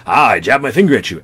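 loud, jabbing accusatory voice; hammed-up accusation with a shift in voice; accusing character voice. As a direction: point the finger and bark it out. Acting out an accusing comic character.

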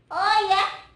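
high, loud little puppet voice; Momon. Pretend. Momon answers Kak Ulin's reminder, high loud puppet voice. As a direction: pipe up brightly, loud and high.

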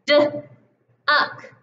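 d-uck split onset and rime; segmented sounds. Shown as a demonstration. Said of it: "what about".

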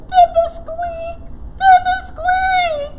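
tiny, very high, slow squeak; squeaky mouse. Acted. Small mouse trying to help frog, squeaking. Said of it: "Squeaked Mouse".

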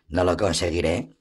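ordinary delivery, nothing marked

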